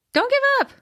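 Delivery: squeaky high cheerleader voice; high-pitched. Pretend. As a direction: pop it up high and bright. Encouraging friend, repeats higher.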